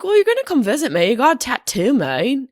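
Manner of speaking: a hint of New Zealand accent. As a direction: do Rose's New Zealand accent, quick and bright. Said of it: "Her accent is amazing".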